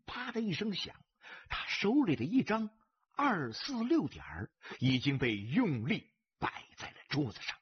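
ordinary delivery, nothing marked

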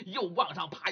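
ordinary delivery, nothing marked

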